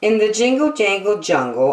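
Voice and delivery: usual speaking voice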